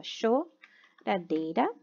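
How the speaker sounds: Choradeira said slowly as cho-ra-dei-ra, each syllable apart; syllables split. Shown as a demonstration. Teacher showing pupils how to split a word, syllable by syllable. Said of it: "separação de sílaba".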